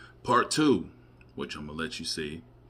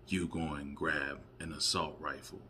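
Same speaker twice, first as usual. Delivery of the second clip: normal talking voice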